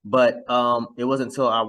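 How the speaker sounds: normal talking voice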